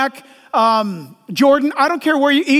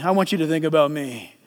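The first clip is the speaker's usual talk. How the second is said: much lower and softer, angling for attention; low, soft self-centred plea; 10 semitones lower, 5 dB quieter than usual. Retold or imitated. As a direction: James quietly pleading for himself. James voiced self-promoting.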